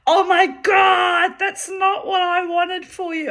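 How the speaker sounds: high, loud shocked cry from the friend; shocked shriek. Retold or imitated. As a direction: shriek it in disbelief. Friend shocked by her story.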